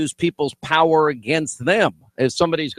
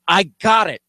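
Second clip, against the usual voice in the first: fed-up reply; 6 semitones higher, slower than usual. Retold or imitated. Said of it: "you're just like".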